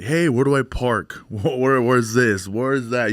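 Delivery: lightly imitated needy callers; mock-pestering string of questions; pestering guests. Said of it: "You know how our people are".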